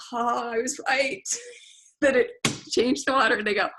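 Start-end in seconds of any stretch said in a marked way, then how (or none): 0.1-1.2 arrogant gloat. Retold or imitated. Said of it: "I arrogantly thought".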